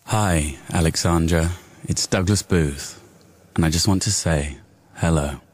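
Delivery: low, slow seductive voice; sexy voice. Acted. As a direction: purr her name slowly and low. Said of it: "say my name in that sexy voice".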